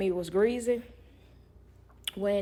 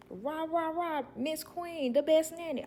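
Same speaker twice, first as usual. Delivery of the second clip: mock cheerleading; 9 semitones higher than usual. Mock praise from the family.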